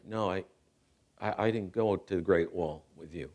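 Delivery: low, soft, halting reply; hushed and downcast; subdued admission. Retold or imitated. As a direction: admit it quietly, with hesitations. Father admits absence, tearful scene.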